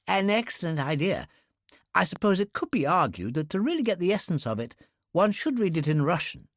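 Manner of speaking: put-on a studious young man showing off; eager young clerk. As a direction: clerk eagerly advising the customer.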